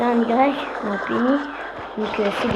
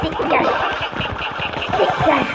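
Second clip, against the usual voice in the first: rising yells, each higher; yelling get out; 6 semitones higher, slower than usual. Real, not acted. Gamer yelling at an opponent to get out. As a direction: shout them away.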